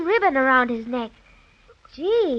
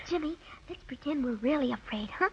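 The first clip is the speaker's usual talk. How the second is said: soft secret whisper; 4 semitones lower, 11 dB quieter than usual. Acted. Sister whispering a plan to her brother, a secret whisper. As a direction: whisper a plan behind the bear's back.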